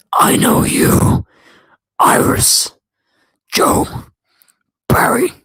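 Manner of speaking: loud stage whisper, slow and sinister; Saboteur voice. Shown as a demonstration. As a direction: hiss each name like a lurking saboteur. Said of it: "Saboteur voice in three, two, one".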